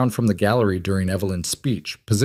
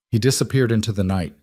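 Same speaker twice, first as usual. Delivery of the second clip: ordinary delivery, nothing marked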